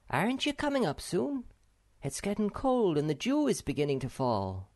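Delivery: high-pitched call, easing off. A narrator's reading. Snufkin urges Sniff up from the top, calling. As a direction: call down to a friend below. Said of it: "shouted Snufkin from the top".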